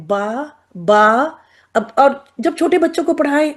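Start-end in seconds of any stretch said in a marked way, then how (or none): 0.1-1.2 slow stretched ba with open mouth; teacher stretching با long and open; drawn-out ba demo. Shown as a demonstration. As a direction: model the syllable با slowly, mouth wide open. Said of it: "یہ با صاحب اوپر ہو گئے".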